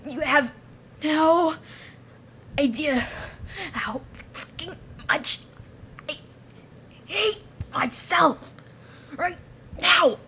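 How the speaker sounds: broken, slow, effortful words; faltering self-hatred. Acted. Creature confessing how it hates itself, faltering. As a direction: force it out in halting bursts. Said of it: "I falteringly speak out".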